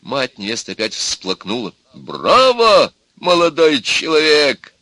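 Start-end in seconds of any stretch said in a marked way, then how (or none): 1.9-4.7 loud, high, drawn-out bravo; exclaiming. A narrator's reading. Grandfather applauding the young suitor, loud exclamation. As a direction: cheer with booming approval. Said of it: "опять воскликнул дед".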